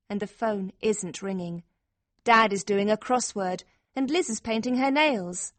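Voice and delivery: usual speaking voice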